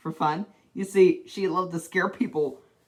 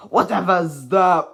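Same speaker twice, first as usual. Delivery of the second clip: loud; 9 dB louder, slower than usual. Acted. Mister Noisy booms before checking himself.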